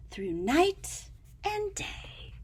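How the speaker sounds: soft whisper. A narrator's reading. Reading the voyage to a child, whispered. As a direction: trail off in a hush.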